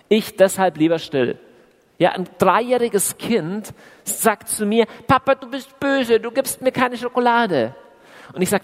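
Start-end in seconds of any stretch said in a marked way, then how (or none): 5.1-7.7 put-on higher, whiny little-kid voice; a light take on a pouting toddler; child's complaint. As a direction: play the sulking three-year-old. Preacher voices a three-year-old child.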